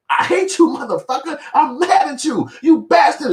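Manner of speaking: high-pitched mock-angry insults; mad but smiling. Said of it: "You be mad as fuck at them".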